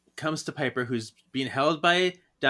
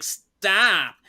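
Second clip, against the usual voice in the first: raised, louder comic "stop"; hammed-up shout to halt; mock yelled command; 6 semitones higher, 5 dB louder than usual. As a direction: yell it out as an exasperated heroine. Piper cries stop in the retelling.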